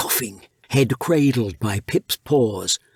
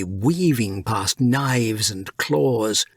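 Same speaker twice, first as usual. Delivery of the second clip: usual speaking voice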